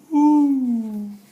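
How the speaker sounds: loud high whoop as if riding down; playful woo. Acted. Reading to kids, a loud playful whoop. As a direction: whoop like sliding down a waterfall. Character going down a waterfall.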